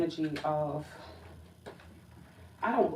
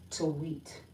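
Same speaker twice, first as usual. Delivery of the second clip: usual speaking voice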